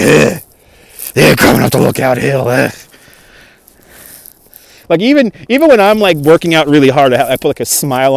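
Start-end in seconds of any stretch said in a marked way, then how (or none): 0.0-2.7 a hint of a grumbling, miserable hiker. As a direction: groan it out like a tired climber. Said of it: "she looked miserable".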